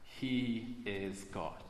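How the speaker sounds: normal talking voice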